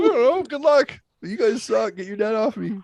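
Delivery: high, loud desperate plea; panicked. Retold or imitated. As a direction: beg frantically for help.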